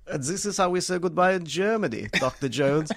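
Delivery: put-on movie villain's German accent drifting toward Australian; bad German accent. Film villain bidding Doctor Jones farewell, bad German accent. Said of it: "doing an Australian accent".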